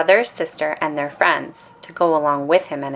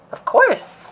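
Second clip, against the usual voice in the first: much higher, squeaky little cub voice; squeaky echo; 3 dB louder than usual. A narrator's reading. Little cub agreeing with Sister, squeaky. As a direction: chirp it back like a small kid.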